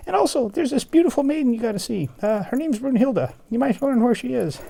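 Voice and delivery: fast, very high chirpy voice; high quick bird. Acted. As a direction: keep the little bird voice. Bird voice continues.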